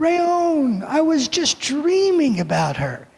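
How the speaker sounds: surprised. Retold or imitated. Woken by the phone, stunned at the riddle's answer. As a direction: blurt out the name in disbelief.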